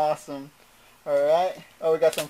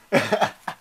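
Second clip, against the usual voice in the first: real laugh; 4 semitones higher, 3 dB quieter than usual. Real, not acted. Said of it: "Oh, I can't stop laughing".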